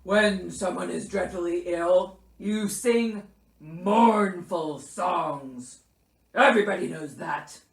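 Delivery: loud, indignant bear lecture; growling Bear. A narrator's reading. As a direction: growl a scolding as a sick bear. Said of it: "growled Bear".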